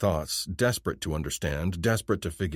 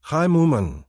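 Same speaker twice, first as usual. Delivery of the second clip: high, bright-eyed greeting from a stranger; alien woman's voice; 9 semitones higher, 7 dB louder than usual. Acted. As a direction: pitch it well up for the alien girl. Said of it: "she says".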